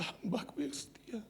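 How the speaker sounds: soft whispered tender words; hushed voice of God. Retold or imitated. God softly calls his faithful servant home, hushed whisper. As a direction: breathe it like a gentle calling. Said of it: "Tuhan berkata".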